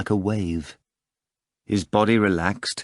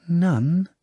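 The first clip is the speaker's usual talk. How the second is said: female voice; 9 semitones higher than usual. Acted. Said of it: "he asked in a female voice".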